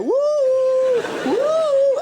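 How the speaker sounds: high, loud whoop. Acted. Devil floating priests, high loud whoop. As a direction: launch it like a ride thrill.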